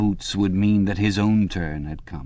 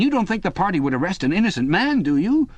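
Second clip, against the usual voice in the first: much higher, eager, anxious chap's voice; a frightened man begging for reassurance, pitched up high; Parsons, nervous and pleading; 11 semitones higher than usual. Acted. Parsons begging Winston in the cell, high anxious pleading.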